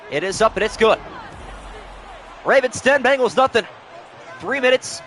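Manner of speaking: lively sportscaster calling the play. Acted. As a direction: call it like a TV broadcaster. Madden play-by-play call.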